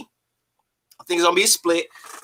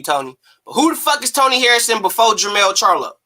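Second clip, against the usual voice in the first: ordinary talk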